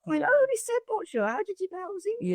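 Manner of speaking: high, fast, put-out complaint; mock indignant. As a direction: pretend outrage at being answered in English. Mock indignation at shop staff.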